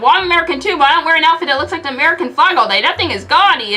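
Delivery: loud indignant rant; shouted. Acted. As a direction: shout it at him. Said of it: "and Tony shouted".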